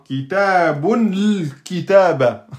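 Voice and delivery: kitabun l then kitaba, slow and jammed together; awkward wrong reading. Shown as a demonstration. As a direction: stumble on the forbidden joining. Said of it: "C'est moche et c'est dur à dire".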